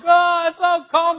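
put-on loud, high whiny complaint from the kids. Daughters complaining about the windy cold, whiny loud. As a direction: wail like cold little girls. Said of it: "they're like".

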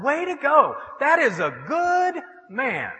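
high-pitched praise with stretched words; admiring cheer. Retold or imitated. Preacher praising a changed man, admiring cheer. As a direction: applaud him, drawing the words out.

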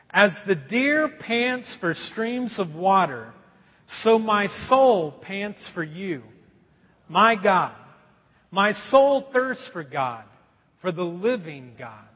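slow, measured reading of scripture. Acted. Pastor leads the church reading Psalm forty-two aloud, slow and measured. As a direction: read it out slowly with the congregation.

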